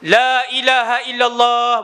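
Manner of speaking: loud declaration of faith; said loudly. Shown as a demonstration. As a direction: proclaim it out loud, slowly. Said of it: "ni saya sebut kuat".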